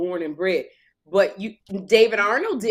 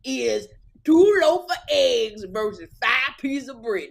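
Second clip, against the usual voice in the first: a lightly voiced game show host, slow and a bit higher; Steve Harvey impression; 6 semitones higher, slower than usual. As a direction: drawl it like a mock TV host. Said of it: "Steve Harvey has a country accent".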